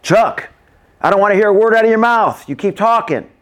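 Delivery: high, loud, fast scolding; angry teacher yelling. Acted. Teacher scolding a kid in class, angry yelling. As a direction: yell at a chattering pupil. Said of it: "being yelled at by a teacher".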